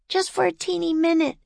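high, quick wheedle; pleading little girl. A narrator's reading. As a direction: coax him quickly in a high child's voice. Girl wheedles her brother.

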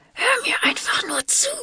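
high, pleading strained whisper; through gritted teeth. A narrator's reading. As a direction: force it out through clenched teeth, begging. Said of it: "stieß Marley mit zusammengebissenen Zähnen hervor". (flehentlich durch zusammengebissene Zähne gepresst)

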